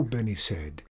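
ordinary delivery

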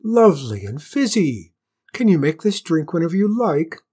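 loud, thrilled queen; high, eager excitement; delighted cry. A narrator's reading. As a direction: gush over a marvellous new drink. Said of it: "she cried".

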